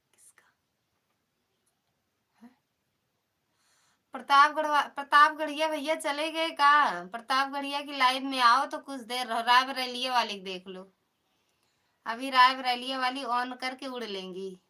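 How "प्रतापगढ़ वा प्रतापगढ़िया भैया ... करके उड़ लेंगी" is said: loud, raised-pitch call to viewers. Real, not acted. Asking where the Pratapgarh brother went, calling out. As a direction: holler it out to the chat.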